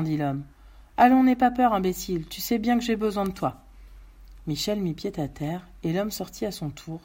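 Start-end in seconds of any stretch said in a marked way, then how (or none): 1.0-3.6 rassure rudement, attaque haute et forte; homme armé impatient. Acted. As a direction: rabroue ton otage pour le faire avancer. (malfaiteur autoritaire qui s'impatiente)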